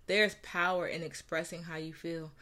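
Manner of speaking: ordinary talk, nothing marked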